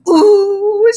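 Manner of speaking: high, loud squeal of excitement; excited ooh. Acted. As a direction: squeal with glee. Excited waiting for her score.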